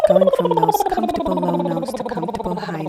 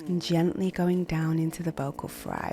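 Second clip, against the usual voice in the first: calm guided exercise. Acted. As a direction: guide them gently through warm-ups. Coach guiding a vocal exercise.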